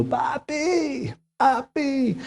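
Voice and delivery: put-on a scared little boy calling for his dad; child calling daddy. Boy on the swing calls his father, lightly voiced child. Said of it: "estaba gritando".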